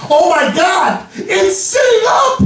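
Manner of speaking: high-pitched startled shriek; panicked shout. Retold or imitated. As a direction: yell in fright like a corpse just sat up. Assistant panics at corpse.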